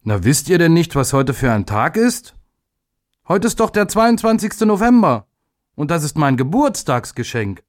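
put-on a boy tells classmates it is his birthday; proud boy. A narrator's reading. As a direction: boast a little about the birthday bike. Proud boy shows his bike.

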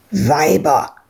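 low grumbled word; grumbling farmer. Acted. The old farmer dismisses Ewke with a low grumble. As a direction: growl it dismissively. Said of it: "knurrte Freak".

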